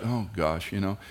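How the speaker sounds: ordinary talk, nothing marked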